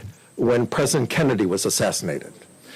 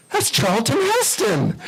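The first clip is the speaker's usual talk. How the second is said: amazed; 10 semitones higher, slower than usual. Retold or imitated. Agent recognises the star.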